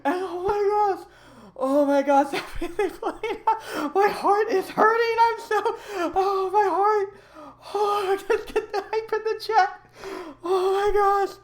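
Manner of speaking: very high, tearful laughing squeal; overcome, wailing between laughs; hysterical relief. Real, not acted. Streamer telling chat how scary it was, hysterical relief.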